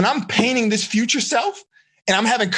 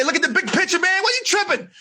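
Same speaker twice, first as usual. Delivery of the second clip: scolding buddy; 8 semitones higher than usual. Retold or imitated. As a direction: shake a friend out of panic. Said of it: "Mick say".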